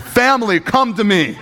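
high, dramatic call to the family; mock-tearful high call; bawling summons. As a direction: summon everyone, hammed-up and emotional. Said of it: "I'm bawling. I am bawling".

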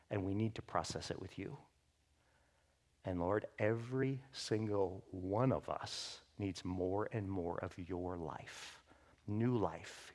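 soft, low prayer voice. Acted. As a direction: pray quietly over the room. Pastor prays for church.

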